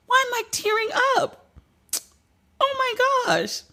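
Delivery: real tears, voice cracking high and breaking; choking up mid-sentence, fighting tears; tearing up. Real, not acted. Host choking up reading [name]'s national hero honor, tearful. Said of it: "I'm really sitting here crying".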